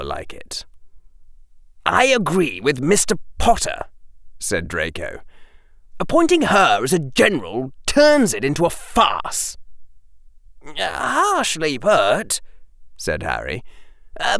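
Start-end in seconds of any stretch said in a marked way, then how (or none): 1.8-3.8 high-pitched, stiff agreement; formal schoolboy backing a point; formal Draco. Acted. Draco agreeing with Harry before Quirrell, formal. 6.0-9.6 high, emphatic schoolboy protest; indignant Draco. Acted. Draco objecting to Quirrell's appointment, indignant. 10.7-12.4 high, very drawn-out words; measured Harry. Acted. Harry responding to Draco, measured.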